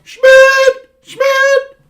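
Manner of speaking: high, loud yelled name, twice; yelling a name. Retold or imitated. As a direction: holler the name across a field. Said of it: "the first name I used to yell".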